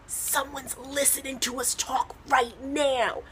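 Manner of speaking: soft, slow, secretive hush; hushed paranoid. Retold or imitated. Comic replaying a hushed warning to her boyfriend, paranoid. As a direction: lean in like the walls have ears.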